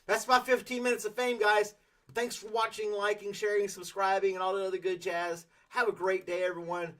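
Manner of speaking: cheerful YouTuber send-off, lifted high; upbeat sign-off. Acted.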